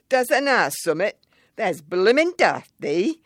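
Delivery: high, loud broad Yorkshire retort; a cheeky child's blunt Yorkshire comeback; Yorkshire boy, deadpan. Retold or imitated. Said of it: "he replied in a deadpan voice".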